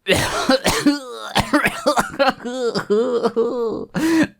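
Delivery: long, high-pitched giggling fit; helpless high laughter that runs on; uncontrolled laughing. Real, not acted. Gamer cracking up over a finished log grind, laughing. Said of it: "I don't know why I'm laughing".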